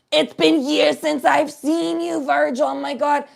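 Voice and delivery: high, drawn-out put-on woman's voice; imitated effusive reunion greeting; gushing old acquaintance. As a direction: gush loudly at a long-lost friend. Woman gushing at dad in story.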